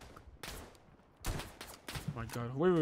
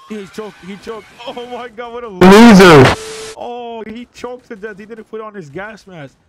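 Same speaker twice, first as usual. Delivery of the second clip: high, loud, incredulous outburst; excited jeering; 7 semitones higher, 22 dB louder than usual. Real, not acted. Player jeering at a man who choked without his gas mask, excited jeering. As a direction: crow over the hapless loser.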